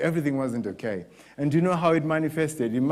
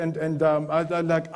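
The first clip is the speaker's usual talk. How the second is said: ordinary delivery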